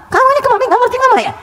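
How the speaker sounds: hammed-up mother scolding her child. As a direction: nag the child like an exasperated mother. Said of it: "kita marah ya".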